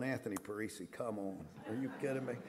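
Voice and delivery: a gentle, weary heavenly sigh of come on; a hint of Jesus despairing of Anthony Parisi; exasperated Jesus. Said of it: "from heaven, be going".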